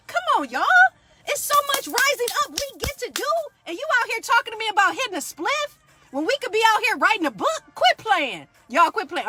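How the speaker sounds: rising, loud, worked-up preaching; real excitement spilling over; fired up. Real, not acted. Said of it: "Okay, let me calm down".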